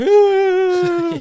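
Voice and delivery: high, loud imitated crying wail; mock bawling. Imitating a kid crying in jail, mock bawling. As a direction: wail like a crybaby, mockingly. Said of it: "bawling, crying".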